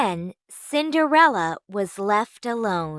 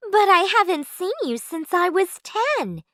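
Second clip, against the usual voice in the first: put-on girlish Cinderella; 8 semitones higher than usual. A narrator's reading. Cinderella voiced high.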